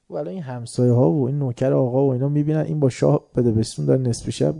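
usual speaking voice

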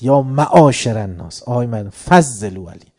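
loud proclaiming call to the people; shouting to the crowd. Retold or imitated. Preacher reenacts the Prophet calling out to the people, loud. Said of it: "بعد داد زد".

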